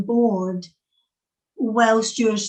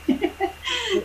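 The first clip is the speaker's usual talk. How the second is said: laughing; 12 semitones higher, 4 dB quieter than usual. Real, not acted. Laughs at technical issues.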